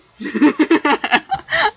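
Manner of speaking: loud rising laugh; loud giggle. Real, not acted. Laughing after singing along.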